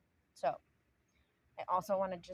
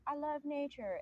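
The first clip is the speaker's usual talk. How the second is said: high, bright mocking singsong; mock sweet claim; 10 semitones higher than usual. Mocking a litterer who claims to love nature, high singsong. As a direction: parody the hypocrite with a lilting lift. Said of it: "Or do you say".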